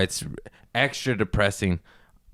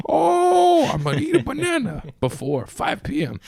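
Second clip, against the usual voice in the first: mock eager resolution; 3 dB louder than usual. Mocking own quarantine resolutions.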